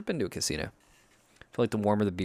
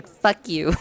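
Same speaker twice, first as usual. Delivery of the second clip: higher soft anime TTS voice; social media tts voice; 9 semitones higher, 4 dB louder than usual. Retold or imitated. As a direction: mimic the soft anime text-to-speech voice. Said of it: "The soft anime T T S voice saying".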